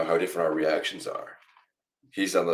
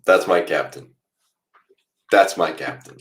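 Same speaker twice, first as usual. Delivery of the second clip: loud proud repeated cheer; 2 semitones higher, 7 dB louder than usual. Real, not acted. Fan cheering captain's goal on stream, proud and loud.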